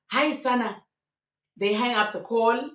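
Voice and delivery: normal talking voice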